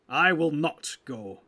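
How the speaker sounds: loud, slow defiance; firm and loud, stretched out; emphatic refusal. Acted. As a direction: refuse forcefully, weighing each word. Warrior flatly refuses.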